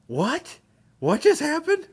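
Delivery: high-pitched, incredulous questioning; baffled disbelief. Retold or imitated. Drunk college viewer reacting to a show's ending, baffled. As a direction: shoot the pitch way up in mock bewilderment.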